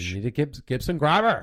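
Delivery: loud West Country "Grabber" with a rolled R; broad yokel "Gibson Grabber"; West Country accent. Acted. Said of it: "Gibson Grabber in the West Country accent".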